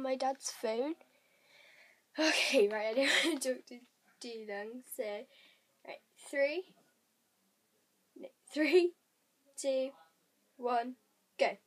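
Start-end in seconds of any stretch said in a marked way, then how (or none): none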